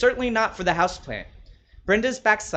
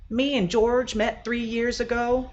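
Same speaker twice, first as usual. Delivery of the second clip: higher, slower chatty woman; higher-pitched voice of Brenda telling her favourite story; Brenda's voice; 8 semitones higher, slower than usual. Acted. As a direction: play Brenda, a fond gossipy host.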